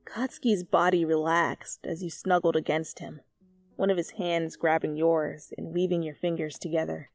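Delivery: ordinary talk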